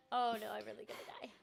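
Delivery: low, quick, soft aside; quiet dread. Real, not acted. Gamer about to lose, quiet quick dread. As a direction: say it fast under your breath. Resigned aside as character nearly dies.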